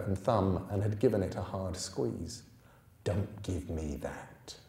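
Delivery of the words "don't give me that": low, slow, soft menace; stern policeman. A narrator's reading.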